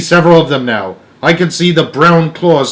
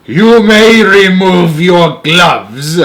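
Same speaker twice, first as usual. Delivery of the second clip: slow, loud, higher commanding shout; witch shout; 6 semitones higher, 6 dB louder, much slower than usual. A narrator's reading. Grand High Witch ordering the room to remove gloves, shouted slowly. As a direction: order it out with rasping menace. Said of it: "she shouted".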